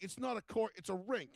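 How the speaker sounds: high imitated heckler; indignant correction. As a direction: correct him in mock indignation.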